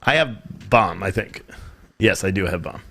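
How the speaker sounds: usual speaking voice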